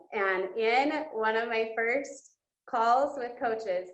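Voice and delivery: ordinary delivery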